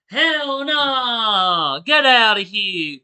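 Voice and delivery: emphatic refusal. Real, not acted. Reacting to the scary story.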